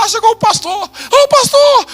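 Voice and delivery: high, stretched tearful cry of pastor; crying old man. Retold or imitated. Old landowner weeping as pastor arrives, high tearful cry. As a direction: wail it out like an old man in tears. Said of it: "ele começou a chorar".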